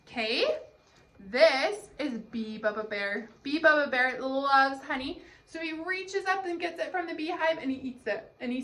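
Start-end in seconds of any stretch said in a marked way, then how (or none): none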